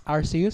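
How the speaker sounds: Arceus with a soft S sound; soft-C pronunciation. Shown as a demonstration. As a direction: pronounce Arceus "AR-see-us". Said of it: "I actually pronounce it as".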